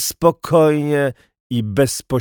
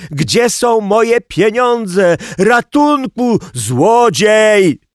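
high, loud, drawn-out yelling; full-voiced panicked wail; robbed man's cry; 10 semitones higher, 7 dB louder, much slower than usual. A narrator's reading. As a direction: cry for help, stretching every word. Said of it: "wołał okradziony".

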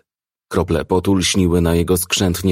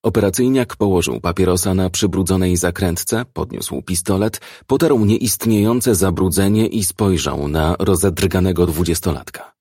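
usual speaking voice

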